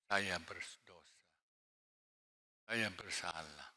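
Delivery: slow, soft, low admission of guilt; slow soft humble confession. Acted. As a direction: confess gently and slowly. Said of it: "Tapi Daud tidak".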